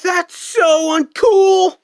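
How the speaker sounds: high, slow shouted complaint; a bratty friend yelling his outrage; indignant shout. Acted. A friend watching the duel yells in indignant outrage.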